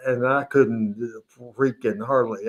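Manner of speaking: ordinary delivery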